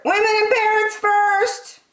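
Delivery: very high squawking parrot. A narrator's reading. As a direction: screech like a parrot giving orders. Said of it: "squawked the parrot".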